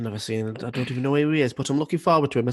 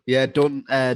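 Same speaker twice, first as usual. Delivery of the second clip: normal talking voice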